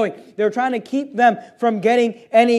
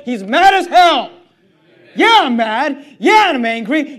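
loud, indignant preaching; real righteous anger; 5 dB louder than usual. Real, not acted. Preacher telling congregation he is angry at YouTube, righteous anger. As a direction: declare it forcefully, with heat.

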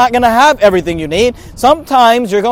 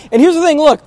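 normal talking voice

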